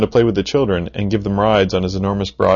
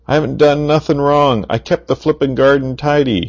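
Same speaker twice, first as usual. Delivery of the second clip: shouting; 6 semitones higher than usual. A narrator's reading. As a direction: yell it, desperate to be believed. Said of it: "shouted Mister Gum".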